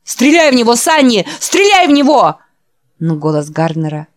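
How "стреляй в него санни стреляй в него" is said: high, loud desperate shout; screaming. Acted. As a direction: scream the order in terror. Orvik screams at Sunny. (отчаянный крик)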